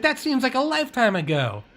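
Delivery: high, amazed look back at the past; lightly voiced astonishment; mock disbelief. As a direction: sell how long ago it feels. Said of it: "You're like".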